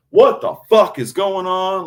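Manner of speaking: high, loud, drawn-out yell. Retold or imitated. Cursing up a storm in the store, slow loud yell. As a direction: yell it out slowly in outrage. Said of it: "fucking yelling about it".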